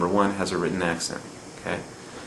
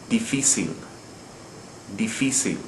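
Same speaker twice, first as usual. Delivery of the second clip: diFÍcil, weight on FÍ; second-to-last syllable stress; 5 dB quieter than usual. Shown as a demonstration. Teacher giving the final example, difícil stressed on FÍ. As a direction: stress "difícil" on FÍ, dee-FEE-seel. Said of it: "We're stressing the second to last syllable".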